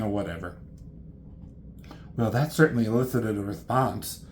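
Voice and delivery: ordinary talk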